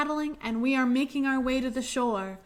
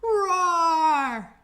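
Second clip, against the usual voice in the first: big louder roar, all out; loud lion roar; 5 semitones higher, 7 dB louder than usual. Shown as a demonstration. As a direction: roar as loud as you can. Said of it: "Make it as loud as you can".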